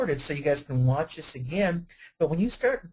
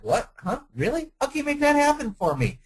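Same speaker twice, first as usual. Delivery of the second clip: high, louder, disbelieving questions climbing up; amazed customer; 9 semitones higher, 3 dB louder than usual. Retold or imitated. Customer hearing of a one-hour sale, amazed and rising high. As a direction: play the stunned buyer who can't believe it. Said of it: "And the customer's like".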